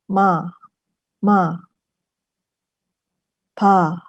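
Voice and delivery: ordinary talk, nothing marked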